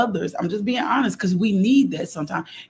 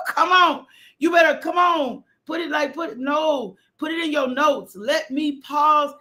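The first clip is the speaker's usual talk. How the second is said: high, pitched-up preaching cry; preaching at full force; 8 semitones higher, slower than usual. Real, not acted. Preacher rousing the congregation about pausing to pray, high ringing preaching.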